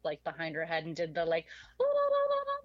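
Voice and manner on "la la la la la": very high, loud mock whooping; imitated hand-over-mouth war whoop. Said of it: "put her hand over her mouth".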